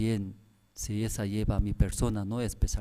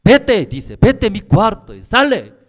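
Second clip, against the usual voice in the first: high, loud expulsion; angry order; 9 semitones higher, 13 dB louder than usual. Retold or imitated. Angry pastor ordering the seminarian out, loud. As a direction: throw him out of the room. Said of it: "Pastor se enojó".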